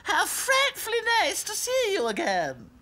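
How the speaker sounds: high, exaggerated posh greeting; posh funny voice. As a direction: ham up a posh voice. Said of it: "all kinds of funny voices".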